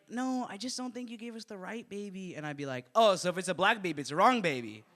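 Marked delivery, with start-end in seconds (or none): 0.1-2.3 high, timid parent voice; meek acted complaint; hesitant objection. Parents politely insist. 2.9-4.8 loud accusing comeback; raised, hammed-up offence; mock indignation. Nurse accuses the parents.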